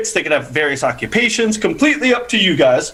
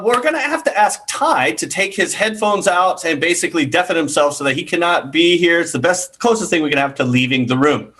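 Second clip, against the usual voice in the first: ordinary delivery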